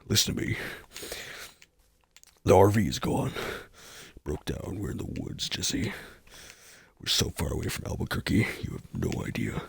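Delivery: low, hushed urgent Walter. Pretend. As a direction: lean in and plead like Walter in crisis. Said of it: "Walter and Jesse".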